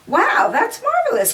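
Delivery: high, delighted "wow" leaping up; excited cry. Acted. Fina hears about the medals from Marlene, thrilled. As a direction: gush with amazement at the news. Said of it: "cried Fina".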